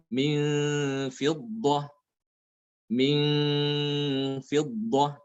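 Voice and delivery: slow chant, long hum on "Min" and ending "Aqiran"; ikhfa before fa. Shown as a demonstration. Teacher demonstrates the letter fa, slow held nasal. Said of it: "pada huruf fa ya".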